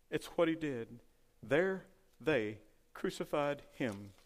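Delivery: ordinary delivery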